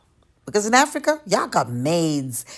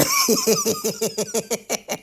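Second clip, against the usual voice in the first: a high, teasing cackle at their expense; high mocking laugh. Real, not acted. Warning new arrivals, then a high mocking laugh. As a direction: laugh high and knowingly, like you enjoy the threat.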